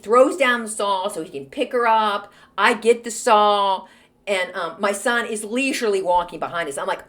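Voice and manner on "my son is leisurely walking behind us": ordinary delivery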